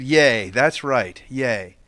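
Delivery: low, slow, understated cheer; low-key adult agreement. Retold or imitated. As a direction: agree calmly, well below a child's excitement. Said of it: "And I said".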